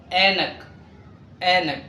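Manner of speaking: عينك without the throat sound, "ainak"; Urdu pronunciation. Shown as a demonstration. Teacher models Urdu عين for learners, عينك as "ainak". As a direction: open عينك on a bare vowel like alif. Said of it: "عين ينطق مثل ألف".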